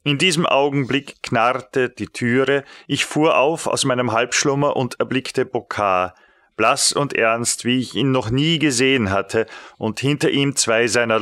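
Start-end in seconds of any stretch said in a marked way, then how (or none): none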